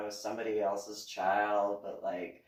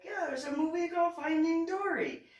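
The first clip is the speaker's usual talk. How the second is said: a much higher, sing-song announcement; sly mom; 4 dB louder than usual. Retold or imitated. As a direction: drop it innocently. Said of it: "I was like".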